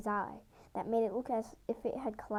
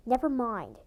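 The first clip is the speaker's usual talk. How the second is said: growled; 6 dB louder than usual. A narrator's reading. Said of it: "he growled".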